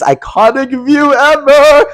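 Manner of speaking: high, loud, drawn-out boast; excited. Real, not acted. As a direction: stretch it out in gleeful hype. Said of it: "And I'm excited".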